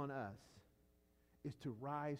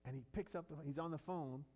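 ordinary talk, nothing marked

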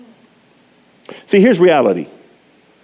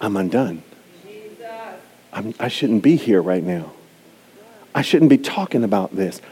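much lower and softer, shrinking away; ashamed inner voice; 11 semitones lower, 7 dB quieter than usual. Retold or imitated. Preacher voicing the listener's shamed instinct, low and soft. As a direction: hush it like a guilty confession. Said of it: "the instinct is to say".

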